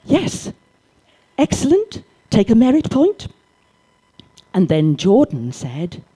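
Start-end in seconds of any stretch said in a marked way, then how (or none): 0.1-3.3 bright, much higher teacher voice praising a pupil; eager teacher praise. Retold or imitated. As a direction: gush over the boy's idea like a keen teacher.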